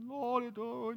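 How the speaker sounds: mock-pious, stretched-out plea; movie-style prayer. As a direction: parody a solemn film prayer. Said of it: "crazy movies".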